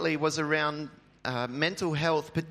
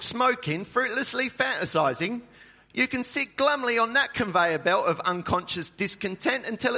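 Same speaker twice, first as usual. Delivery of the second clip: put-on Russell Brand accent; 7 semitones higher than usual. Pastor quotes Russell Brand to the church in a light British accent. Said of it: "I should try and do it in his accent".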